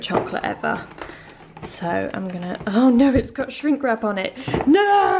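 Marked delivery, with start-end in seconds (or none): 4.7-5.2 frustrated no. Real, not acted. Shrink wrap annoyance.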